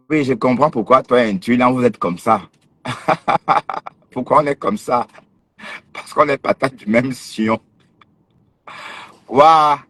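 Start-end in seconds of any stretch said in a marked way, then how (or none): 2.8-3.9 short high teasing laugh; a scornful giggle; high mocking laugh. Real, not acted. Laughing at rival's reply. 9.3-9.8 high, loud mocking wow; a big sarcastic wow. Real, not acted. Mock exclamation again.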